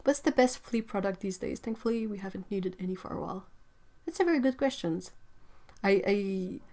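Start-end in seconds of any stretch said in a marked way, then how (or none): none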